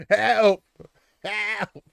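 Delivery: high, loud squawked help; parrot squawk. Retold or imitated. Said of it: "learned to say".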